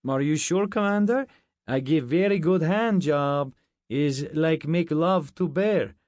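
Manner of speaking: proud broken-English boast; Russian soldier insisting to his commander; Russian accent. Acted. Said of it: "This one was Russian".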